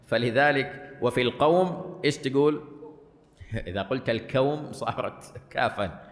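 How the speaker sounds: ordinary talk, nothing marked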